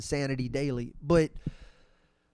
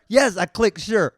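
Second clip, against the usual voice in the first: loud, raised exasperated reply; mock-exasperated yes; 5 semitones higher, 11 dB louder than usual. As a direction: answer a nagging app at full volume. Exasperated reply to nagging app.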